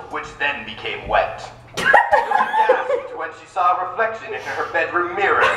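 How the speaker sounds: mock-serious romance novel narration; hammed-up audiobook narrator; dramatic reading. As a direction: read the steamy prose with straight-faced drama.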